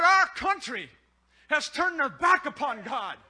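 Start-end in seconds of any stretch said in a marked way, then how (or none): none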